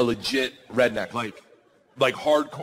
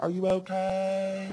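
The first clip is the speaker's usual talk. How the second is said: higher, slow, drawn-out angelic voice; dreamy concern; 5 semitones higher, 3 dB quieter, much slower than usual. Retold or imitated. Wife leaning over her fallen husband, dreamy concern. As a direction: stretch it out like a slow movie moment.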